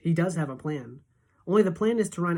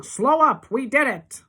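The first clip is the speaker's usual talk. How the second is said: excited triumph; 9 semitones higher, 8 dB louder, slower than usual. A narrator's reading. Calling to stop in pond.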